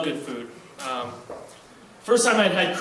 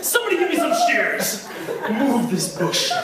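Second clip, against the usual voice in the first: baffled enemy; 9 semitones higher than usual. Pretend. The enemy finding a shrubbery in his way, high and laughing. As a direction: play the dumbfounded enemy stopped by a shrub. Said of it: "Enemy comes around".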